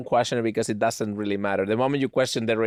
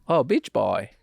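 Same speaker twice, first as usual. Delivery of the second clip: a weak, near-plain Chinese accent; Chinese accent, barely there; 4 semitones lower, slower than usual. Shown as a demonstration. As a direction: try a Chinese accent and drop the S. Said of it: "I didn't hear a difference".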